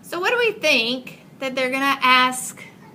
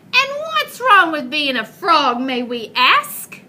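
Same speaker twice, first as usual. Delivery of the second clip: higher, louder huffy frog chorus; offended frogs exclaiming; 5 semitones higher, 6 dB louder than usual. Acted. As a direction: frogs bristle and fire back. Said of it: "exclaimed the frogs".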